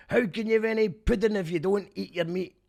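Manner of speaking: put-on high, loud shout in a genuine Scottish accent; actual Scottish accent. Excited for an actual Scottish person's take, loud Scottish accent. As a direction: holler it like a real Scot. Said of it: "an actual Scottish person going".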